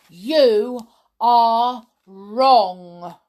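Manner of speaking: loud, very slow, word by word; bold announcement. A narrator's reading. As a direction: announce it with great weight, each word apart. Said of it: "Tom announced".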